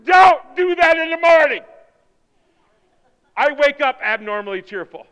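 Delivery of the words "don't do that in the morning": high, shouted objection; loud protest. Acted. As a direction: fend off morning cheer loudly. Acted objection to morning cheer.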